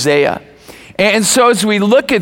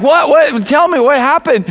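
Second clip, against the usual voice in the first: re-enacted urgent, higher-pitched pressing; alarmed questioning; 7 semitones higher than usual. Husband presses his crying wife for news, alarmed questioning. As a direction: press anxiously for the news, retold.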